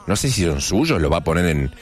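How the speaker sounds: normal talking voice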